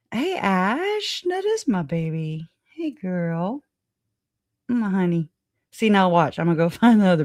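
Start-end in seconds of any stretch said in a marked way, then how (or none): 0.1-5.3 high, sweet pet-talk greeting. Real, not acted. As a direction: coo it up high to the dog. Greeting her pet dog.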